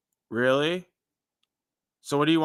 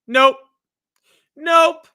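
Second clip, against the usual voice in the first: much higher and louder, shouted nope; emphatic nope; 12 semitones higher, 10 dB louder than usual. Acted. Testifying that nothing else worked, a loud high nope. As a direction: slam the door on it. Rejecting everything besides Jesus.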